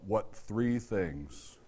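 slow, hushed self-question; low and slow, letting it sink in; soft reflective question. Shown as a demonstration. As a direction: ask it quietly, inward. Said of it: "good question to ask yourself".

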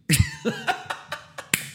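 sharp high cackling laugh; high cackle. Real, not acted. Laughing at the Lysol crime report, high cackle.

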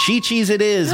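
bright, high game-show confirmation; excited. Real, not acted. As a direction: announce the right answer with glee. Host confirms right answer.

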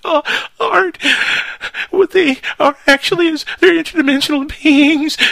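very high, loud trembling impression; frantic screaming caller. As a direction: play a terrified caller breaking down on air. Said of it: "all frantic and, and screaming, like".

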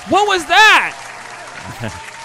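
high, loud outburst; startled shout. Acted. Actor reacting to the audience cheers, high startled shout. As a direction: shout it in sharp alarm. Scream after cheers, performer yelling.

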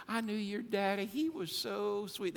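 lightly voiced admiring lady; sweet old lady. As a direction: coo it like a fond churchgoer. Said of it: "She said".

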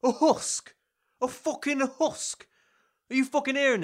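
high, affronted incredulity; indignant disbelief. Acted. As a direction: repeat the insult as if you can't believe it.

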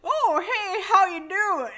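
hammed-up sultry voice, pitched high and loud; sex voice. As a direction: play a mock seductive come-on, loud and high. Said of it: "my sex voice will get like".

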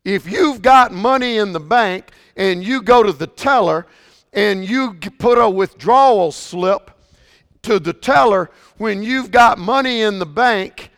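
loud, high, building preacher's cry; fired-up pulpit delivery; preaching at full force. Acted. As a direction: preach it hard to the congregation. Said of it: "I almost got a little excited there".

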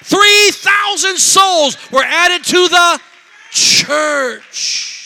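high, loud, drawn-out shouts to the congregation; full-force preaching. Acted. Preacher to congregation on three thousand souls saved, full-force preaching. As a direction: belt it out slowly to rouse the church.

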